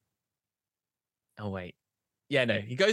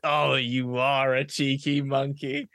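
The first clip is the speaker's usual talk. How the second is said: slow, drawn-out indulgent reply; indulgent parent; slower than usual. Acted. Parents letting their son get away with it, slow indulgent drawl. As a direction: stretch it out, giving in fondly.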